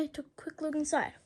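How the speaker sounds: usual speaking voice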